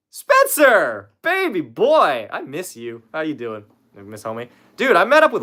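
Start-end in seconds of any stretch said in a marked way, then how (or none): 0.1-2.3 loud, high, drawn-out call; delighted holler, pitched up; excited greeting. Real, not acted. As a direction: call out to a long-missed friend, stretching the words. Excitedly greets a friend arriving.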